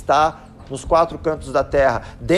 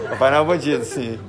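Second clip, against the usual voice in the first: deadpan confirmation; 4 semitones lower than usual. Retold or imitated. Dad backs up his son before the friend, retold deadpan. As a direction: confirm it drily, letting the voice sink. Dad's reluctant-sounding confirming reply.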